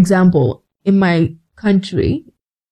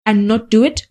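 normal talking voice

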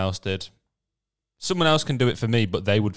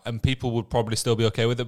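ordinary delivery

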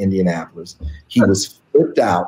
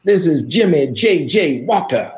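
slow, lightly voiced celebrity self-introduction; Jimmy Walker voice; 6 semitones higher than usual. Star calling a kid comic on the phone, slow showman intro. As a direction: announce yourself like a star, slowly. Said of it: "And he goes".

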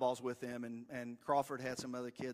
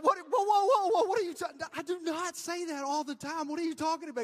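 much higher, louder startled denial; flustered shock; 8 dB louder than usual. Retold or imitated. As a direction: protest caught off guard.

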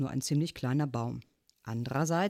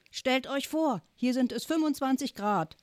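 high raised voice over a phone line; shouting; 9 semitones higher than usual. A narrator's reading. Uncle boasting of beach heat over speakerphone, raised high voice. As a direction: call it out cheerfully, pitched way up. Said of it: "schrie Onkel Max".

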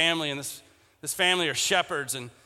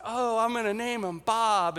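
lightly voiced eager kid, higher; child voice; 4 semitones higher than usual. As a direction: sound like a thrilled little kid. Kids naming the new lamb.

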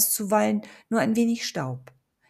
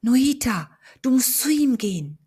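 much louder and higher eerie call; commanding spirit voice; 6 semitones higher, 7 dB louder than usual. A narrator's reading. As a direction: ghost urging her on.

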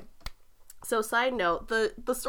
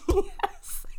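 sarcastic chirpy yes; 11 semitones higher than usual. Retold or imitated. Princess mock cheerful yes.

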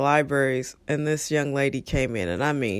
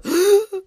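mock gasp; 4 dB louder than usual. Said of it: "She goes".

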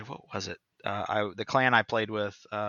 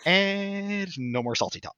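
smug; 10 semitones higher, slower than usual. Retold or imitated. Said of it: "I'm just like".

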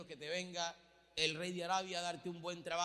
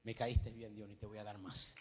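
low, soft kindly voice; a hint of a gentle divine voice; lightly voiced God; 12 semitones lower, 6 dB quieter than usual. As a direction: speak low as a pleased king.

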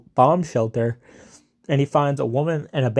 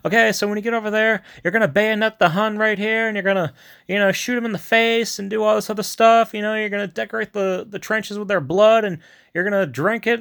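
high-pitched mock pep talk; energetic, hammed-up officer's briefing; commander's prep talk; 8 semitones higher than usual. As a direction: imitate a commander briefing the troops. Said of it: "giving prep talks to the guys".

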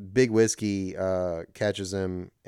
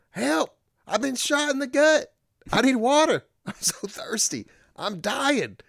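much higher, whining wounded cowboy; hammed-up dying man. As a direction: wail like a gut-shot man begging. Shot man crying out, retold.

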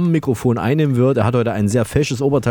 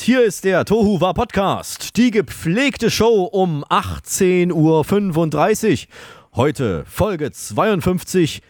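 higher, showy host opening; announcer voice; 8 semitones higher than usual. Acted. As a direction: open the show like a radio host. Formal show opening.

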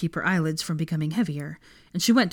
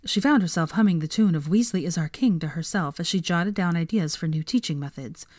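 ordinary delivery

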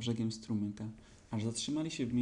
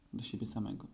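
ordinary delivery, nothing marked

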